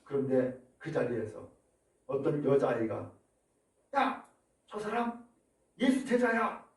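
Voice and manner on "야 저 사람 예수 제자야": put-on girl pointing out. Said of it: "라고 지적을 한 거예요".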